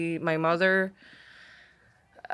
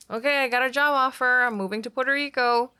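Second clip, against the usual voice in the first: higher-pitched, breezy imitation of mom; mock-casual quote, pitched up; imitating mom; 7 semitones higher, 3 dB louder, faster than usual. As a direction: announce it lightly as mom would. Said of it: "You just like".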